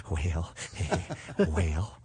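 low, laughing president drawl; deep wells broken by a laugh; Ronald Reagan impression. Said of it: "best Ronald Reagan ever".

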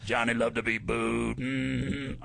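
low grunting mm-hmm drawl; lightly voiced Sling Blade. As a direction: mimic a slow mumbling writer. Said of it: "He turns to me and goes".

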